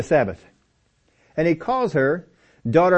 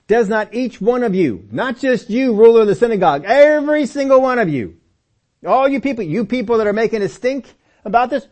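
higher-pitched, preached accusation; emphatic rebuke; 7 semitones higher, 4 dB louder than usual. Acted. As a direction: call out every one of them, drawing out the key words. Acting out Jesus rebuking the crowd.

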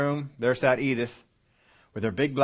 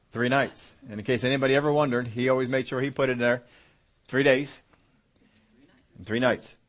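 normal talking voice

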